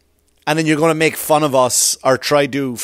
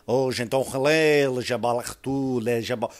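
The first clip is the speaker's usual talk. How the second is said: fake French gibberish; 5 dB quieter than usual. Comic showing how fake French sounds, softly.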